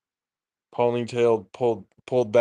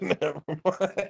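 mocking echo; 6 semitones higher, 6 dB quieter, slower than usual. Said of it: "He said".

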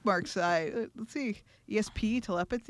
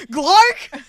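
Glark blurted louder, a sudden mock shout; mock yell; 6 semitones higher, 10 dB louder than usual. Friend retelling the host's sudden yell, mock shout. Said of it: "You just yelled".

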